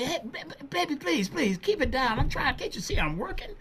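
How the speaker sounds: high, teasing comeback voice; quick-witted MC. Retold or imitated. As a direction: put the heckler down with a grin. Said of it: "He said".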